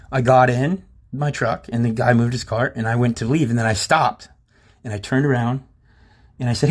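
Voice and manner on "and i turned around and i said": ordinary delivery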